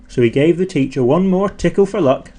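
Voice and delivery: ordinary delivery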